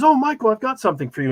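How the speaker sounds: high, quick put-on British accent; mock British accent, brisk and friendly; Jeremy handing over an envelope, bad British accent. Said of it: "and he goes".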